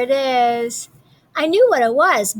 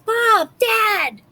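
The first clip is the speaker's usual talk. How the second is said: calling parents; 10 semitones higher than usual. Acted. Boy calls parents about pirates.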